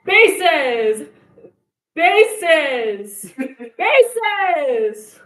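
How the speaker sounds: loud, high repeated call to the basses. Pretend. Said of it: "And so it's like".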